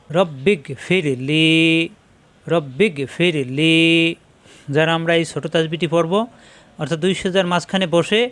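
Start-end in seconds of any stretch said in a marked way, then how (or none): 0.1-3.8 slow, syllable by syllable, each sound held clear; measured Quran teacher's recitation; slow recitation demo. Shown as a demonstration. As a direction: spell it out slowly for a student copying you. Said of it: "মাঝের হরফ এক আলিফ টান".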